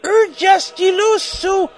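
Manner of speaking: very high, slow put-on squeal; hammed-up, stretched falsetto rant; author's note voice. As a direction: draw each word out in high pitch. Acts out the shrill author's note.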